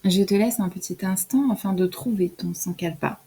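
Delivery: ordinary talk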